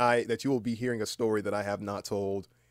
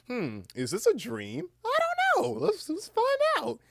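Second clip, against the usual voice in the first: much higher, louder goofy wondering; hammed-up musing; 6 dB louder, slower than usual. Waking sleeper asks themselves about dreaming, hammed-up musing. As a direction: squeal it out like a silly inner voice. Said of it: "you think to yourself".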